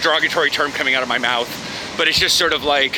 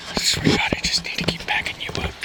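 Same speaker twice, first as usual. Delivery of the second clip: whisper; 3 dB quieter than usual. Real, not acted. Mutters to the camera while walking.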